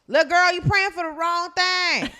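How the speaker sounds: slow, high, loud telling-off; loud scolding. Acted. Secretary correcting the praying woman, loud drawn-out scolding. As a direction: belt it out slowly to set her straight.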